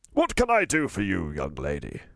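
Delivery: posh, well-spoken tramp voice; posh old tramp. A narrator's reading. Old tramp greeting the girl, posh voice. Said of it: "His voice was unexpectedly posh".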